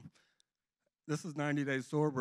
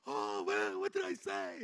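dazed wondering; 4 semitones lower, slower than usual. Retold or imitated. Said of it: "I go".

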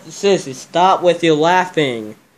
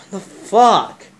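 startled; 5 semitones higher, 5 dB louder than usual. Real, not acted. Startled by a prank scare.

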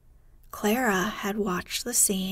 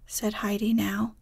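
ordinary delivery, nothing marked

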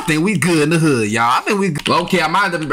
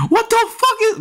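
outraged disbelief. Real, not acted. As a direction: burst out at the screen. Game frustration outburst.

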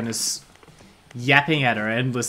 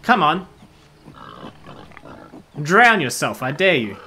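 taunting dare; 4 semitones higher, 7 dB louder than usual. Real, not acted. Taunting a creature in game.

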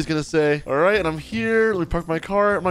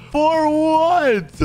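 high, louder "for what?"; alarmed; 11 semitones higher, 4 dB louder than usual. Real, not acted. Learning his friend will be there tomorrow, alarmed and high. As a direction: fire the question back in alarm. Friend invites girl over.

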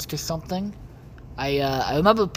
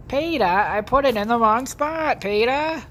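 higher, louder Lois Griffin voice; Lois impression; 7 semitones higher, 5 dB louder than usual. Lois whining to Peter about the wrong spot. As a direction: wail it like Lois calling Peter.

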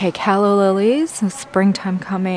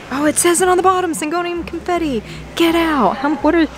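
high-pitched, breathless-with-joy gushing; excited; 8 semitones higher than usual. Real, not acted. Shopper checking the planter and label of a find, excited. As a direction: gush high over a lucky find. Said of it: "I get excited".

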